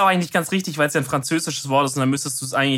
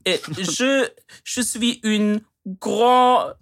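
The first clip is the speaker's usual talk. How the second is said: mock French; 7 semitones higher, much slower than usual. Joker playing a Frenchman addressing Madame, mock French. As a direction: ham up French, high and drawn out.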